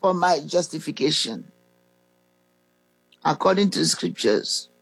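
slow, measured prayer recital with long pauses. Real, not acted. Preacher leading listeners to repeat a salvation prayer after him, slowly. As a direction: lead the prayer phrase by phrase, drawn out. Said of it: "I'm going to say it slowly".

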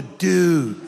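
exaggerated California dude; hammed-up Californian surfer talk; California accent. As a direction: put on a laid-back California dude accent. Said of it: "Or California".

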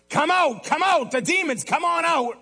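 commanding demons out. Acted. Believer casting demons out of a person, urgent command. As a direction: order them out urgently, pitch way up.

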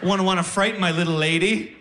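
high mock-concerned sarcasm; sarcastic. Answering advice not to scare her, high sarcastic concern. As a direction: feign great concern, pitch lifted.

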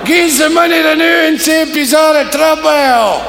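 high, loud shouted exchange; loud Glasgow circus shouting. Acted. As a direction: bellow across the tent.

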